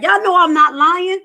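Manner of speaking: high and loud, insisting; loud exclamation. Real, not acted. Telling her viewers she isn't lying, in a loud high exclamation. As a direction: call it out to the crowd.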